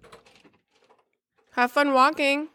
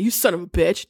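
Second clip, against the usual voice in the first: dramatic audiobook reading; 6 semitones lower than usual. Shown as a demonstration. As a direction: read it like an audiobook narrator. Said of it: "Like this".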